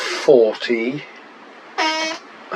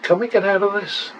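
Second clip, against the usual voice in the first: anxious; 9 semitones higher, faster than usual. Real, not acted. Stuck at the max bet.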